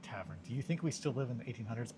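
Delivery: usual speaking voice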